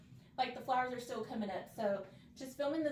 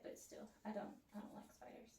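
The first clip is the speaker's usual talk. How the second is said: breathy whispered complaint; 14 dB quieter than usual. Real, not acted. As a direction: drop to a whisper. Fading dislike of spiders.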